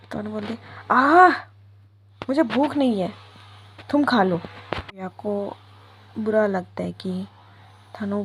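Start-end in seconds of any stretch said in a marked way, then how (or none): 0.9-4.4 loud exasperated groan then refusal; annoyed outburst. Acted. Tanu refuses Viya's food with a loud fed-up sigh. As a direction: push her away, fed up.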